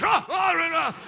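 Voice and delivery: high, loud startled yelps; startled gasps. Retold or imitated. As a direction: gasp awake in mock fright. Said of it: "And I'm like".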